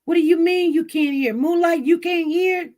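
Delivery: ordinary delivery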